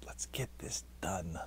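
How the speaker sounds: soft unvoiced whisper. Real, not acted. Vlogger voicing the inner urge to finish, whisper. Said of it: "part of me that's saying".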